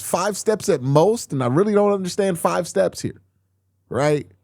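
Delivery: venting disbelief at a botched easy play; exasperated rant. Real, not acted. Frustrated rant about an easy throw.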